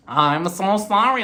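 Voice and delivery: slow, drawn-out mock apology; Meredith. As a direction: draw out the sorry in imitation.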